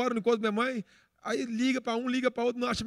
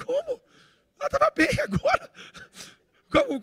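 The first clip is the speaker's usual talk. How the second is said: very high, loud squeal of disbelief; shocked disbelief; 3 dB louder than usual. Retold or imitated. Son retelling how he heard his mother died, high-pitched shock. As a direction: blurt it out in a shrieking mock panic. Son's shocked reaction, re-enacted.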